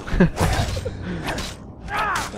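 long high-pitched cackling laugh; high cackle. Real, not acted. Gamer laughs at his own paladin joke, high cackle.

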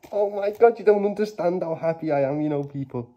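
real joyful outburst; overjoyed. Real, not acted. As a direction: gush it out, overjoyed. Overjoyed at the pull.

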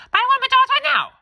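put-on very high, loud child voice demanding; child's tantrum. Little boy shrieking at his parents in the mall.

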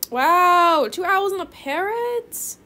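can't believe the offer; amazed wow. Real, not acted. Amazed by two owls and a parrot offered, loud and high.

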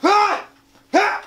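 high, fast furious shouting; angry shout. Acted. Escalating shouted confrontation.

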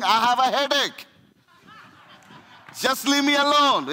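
mock fed-up wife, pushing him away; annoyed wife. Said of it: "she's telling".

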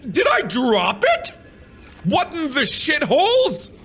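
startled squeal; shocked high outburst. Real, not acted. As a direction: voice shoots up in disbelief. Shocked outburst, pitch jumps high.

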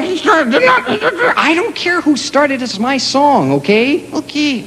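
tiny puppet insisting it's his song; high puppet voice. Acted. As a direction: squeak it slowly, annoyed. Puppet argument over the song.

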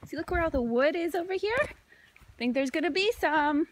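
normal talking voice

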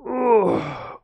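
loud, high-pitched groan; aching groan. Real, not acted. Streamer whose bones hurt, aching groan. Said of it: "my bones hurt".

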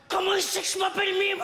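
high, shouted astonishment; the boy amazed that the teacher knows his name; stunned kid. Retold or imitated. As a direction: blurt it out in shock.

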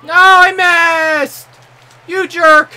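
high, loud yell of frustration; frustrated shout. Real, not acted. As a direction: explode over a missed shot. Missed a shot in game.

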